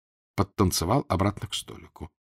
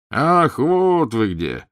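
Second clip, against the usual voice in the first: loud, slow, high exclamation; exclaiming prince; 6 semitones higher, 6 dB louder, much slower than usual. A narrator's reading. Prince spots the Karpovs, loud drawn-out cry. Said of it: "вскричал князь".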